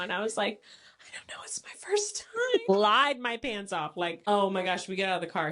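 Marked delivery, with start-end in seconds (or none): 1.0-2.3 tiny whispered fake-innocent excuse; sheepish whisper. Whispering a fake innocent excuse to the cop. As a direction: whisper it like a caught kid.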